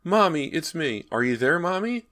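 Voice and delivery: put-on high, scared small boy's voice; a lost child calling for his mother, afraid; a small ghost boy's trembling call; frightened little boy. A narrator's reading. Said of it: "a little boy's frightened voice".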